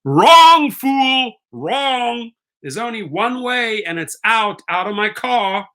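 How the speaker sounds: high, loud wrong, then a firm finish; loud rebuke. Real, not acted. Driver telling a scamming rider to get out, loud high rebuke. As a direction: bark it down at him.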